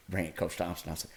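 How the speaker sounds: normal talking voice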